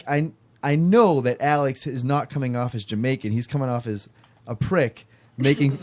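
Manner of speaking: ordinary talk, nothing marked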